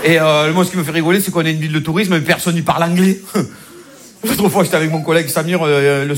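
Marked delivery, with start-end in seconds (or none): none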